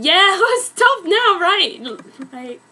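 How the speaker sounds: can't keep a straight face, laughing it out. Real, not acted. Reader cracking up mid-reading.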